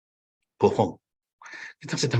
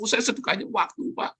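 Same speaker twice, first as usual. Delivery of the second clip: higher, softer whiny excuse voice; mock congregant excuse; 9 semitones higher, 4 dB quieter than usual. Preacher quoting the stingy churchgoer again, soft and higher. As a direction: repeat the excuse in a smaller mocking voice.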